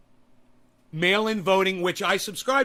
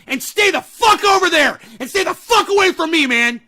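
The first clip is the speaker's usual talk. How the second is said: higher, louder rant that boils over into a yell; furious shouting; 4 semitones higher, 6 dB louder than usual. Real, not acted. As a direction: scream it at the listeners who disagree. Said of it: "I get so fucking angry".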